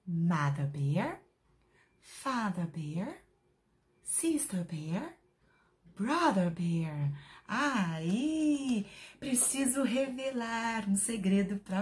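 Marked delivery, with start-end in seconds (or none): 0.1-7.1 each name slow with a rising end; slow, clear English for little learners; slow teaching drill. Shown as a demonstration. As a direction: model the names slowly for kids. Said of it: "Vamos lá mais uma vez?".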